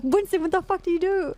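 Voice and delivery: high-pitched, retold outrage at a friend; exasperated scolding. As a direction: demand an explanation, acting out your exasperation.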